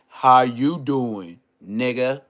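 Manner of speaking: slow drawn-out talk show greeting; Wendy Williams impression. Telling the guest to copy Wendy Williams, slow drawn-out greeting. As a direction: stretch the greeting like a daytime host. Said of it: "just go ahead and say".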